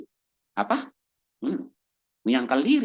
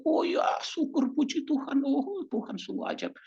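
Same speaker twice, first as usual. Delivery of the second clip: grateful believer; 12 semitones higher, 3 dB quieter than usual. Retold or imitated. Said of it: "Kamu terima dengan hati".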